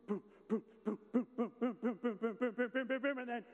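loud, punchy boom-boom rhythm; loud mock chess-move booms; rapid boom sounds. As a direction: hammer out each move. Chess moves struck in sequence.

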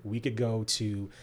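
ordinary talk, nothing marked

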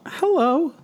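high, squeaky nervous hello. Acted. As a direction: greet like a jittery suitor. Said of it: "That was my nervous voice".

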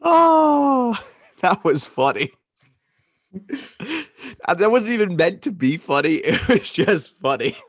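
high, laughter-filled speech; real laughing fit. Real, not acted. Telling co-host it was funny, still laughing. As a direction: talk through leftover laughter.

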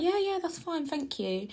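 high, soft sing-out reply; polite reassurance. Retold or imitated. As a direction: act out an over-polite brush-off, pitched high. Acting own polite reply to driver.

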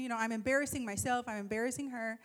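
ordinary delivery

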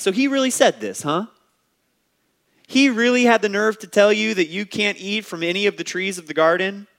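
high, incredulous, teasing Satan; the serpent egging Eve on, in high mock disbelief. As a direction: play the serpent faking shock at God's rule. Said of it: "It's like Satan looks at Eve, goes".

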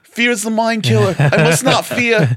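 put-on squeaky Boston accent on a Dune line. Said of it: "Come on, get your Boston accent".

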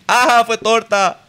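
high, drawn-out teasing jeer; mock taunting. Schoolmates mocking him about his parents' ages, high drawn-out jeer. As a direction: sing out the taunt, stretched and high.

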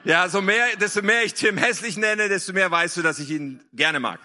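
ordinary talk, nothing marked

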